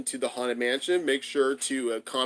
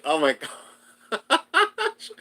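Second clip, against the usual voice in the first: drawn-out laughing oh my gosh, higher; 10 semitones higher, 3 dB louder, much slower than usual. Real, not acted. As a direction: laugh through the words slowly. Reacting to Jack Skellington laugh.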